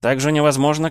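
невозможно echoed high and loud, rising; mocking echo. Mocking echo of his word.

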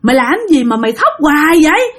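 high-pitched exasperated scolding; acted angry outburst, high but not shouted; cursing at a child. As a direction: snap at a crying child, pitch jumping up. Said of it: "cái chị mới chửi".

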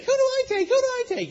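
put-on high, eager kid asking who to tackle; squeaky young boy begging for a job; little kid voice. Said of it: "And so Tim's like".

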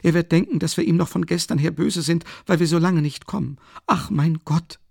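hohe, aufgeregte Stimme einer ängstlichen Mutter; besorgte Mutter. A narrator's reading. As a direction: eine nervöse alte Mutter, etwas lauter. Mother figure, high voice. (Mutter sorgt sich um den Sohn, hoch und aufgeregt)

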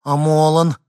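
put-on high, loud, plaintive child's query; plaintive question. A narrator's reading. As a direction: ask it pleadingly, like a small boy. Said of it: "жалобно спросил". (жалобно, по-детски)

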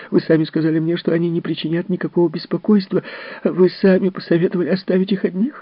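high, tearful defensive outburst; tearful shouting nurse. Acted. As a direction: blurt it out, crying and defensive. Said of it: "выкрикнула вдруг Мэнди". (высокий плаксивый выкрик)

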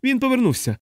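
high urgent shout. A narrator's reading. Someone shouts at the one-legged patient, urgently. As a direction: yell it at the panicking man. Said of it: "крикнув йому хтось".

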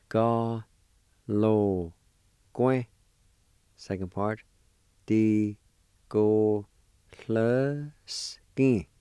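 Ga-lo-kwe and Di go hluskin split into careful syllables. Shown as a demonstration. Teacher breaking gunsmith into parts. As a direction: sound out Ga-lo-kwe, then Di go hluskin. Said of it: "First part is pronounced like this".

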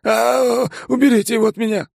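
very high, fast terrified plea; terrified shriek. Acted. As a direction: scream for help in terror. Bond terrified, hiding.

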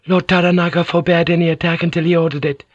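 Mariko. A narrator's reading. Mariko's voice, narrator.

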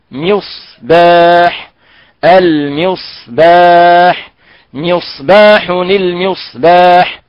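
مصباح and المصباح said very slowly with a thin light س; correct Quranic reading. Shown as a demonstration. Teacher drilling مصباح with the class, very slow. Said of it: "نقول أن المصباح هذه لغة القرآن".

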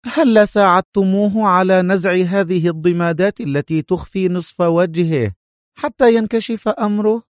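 high-pitched voiced woman; insistent, high character voice; Sonia's character voice. Narrator voices Sonia's line.

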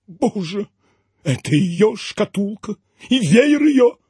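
slow, rising tearful lament. A narrator's reading. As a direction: choke it out as if sobbing. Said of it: "всхлипнул". (всхлипывая, с надрывом)

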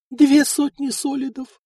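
a sighing lament pitched high. A narrator's reading. Wife sighs over the money lost. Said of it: "вздохнула Эльфрида".

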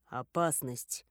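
low, slow and curt; dry. A narrator's reading. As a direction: say it flat and terse, slowly. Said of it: "сухо произнёс тот". (сухо, низко и медленно)

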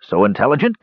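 a touch of sly flattery; cunning. A narrator's reading. Dwarf sizing up the young mage, cunning. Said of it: "continued Dugan cunningly".

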